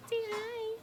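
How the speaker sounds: very high, soft coo to a pet; sing-song pet voice, high and soft; pet talk. Real, not acted. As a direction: coax the pet in a tiny high voice. Coaxing her pet to greet.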